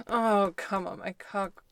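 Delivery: drawn-out loud urging moan; loud moaning urge. Acted. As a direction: let it out as a moan. Whispered then moaned repeat.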